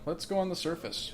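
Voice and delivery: usual speaking voice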